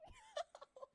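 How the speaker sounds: high panicked laughing scream; hysterical shriek laugh. Retold or imitated. Spider ran into her shoe, shrieking hysterical laugh. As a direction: laugh and shriek at once, out of control.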